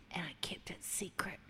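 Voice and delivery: hushed, breathy mock deathbed whisper. Mocking podcast father on deathbed, hushed mock whisper. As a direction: whisper it like a dying dad. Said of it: "on his deathbed".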